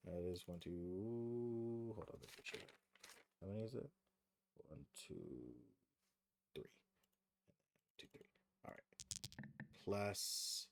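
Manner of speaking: tally it quietly, just for yourself; counting to oneself. Real, not acted. Game master totting up damage dice to himself, very quietly.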